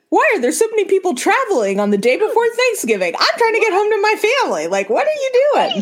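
high-pitched, hammed-up outrage; mock indignation. Traveler complaining about pre-Thanksgiving crowds, high mock indignation.

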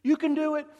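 put-on high, sing-song baby talk; crouched down coaxing a baby to walk; coaxing a toddler. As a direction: coo to a toddler taking first steps. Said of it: "You get down and you go".

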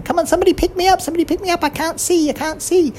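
very high squeaky mouse begging to be lifted; tiny excited mouse squeak; squeaking to be picked up. Acted. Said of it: "hopping around ... saying".

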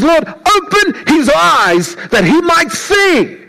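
high, pleading prayer. Retold or imitated. Elisha praying for his servant's eyes, high pleading voice. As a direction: beg God to open his eyes. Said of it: "he prays and he says".

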